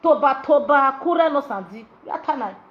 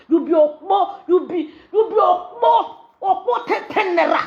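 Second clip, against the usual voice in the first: laughing shout; 5 semitones higher than usual. Real, not acted. Insulting taunt, shouted laughing.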